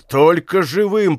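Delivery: ordinary delivery, nothing marked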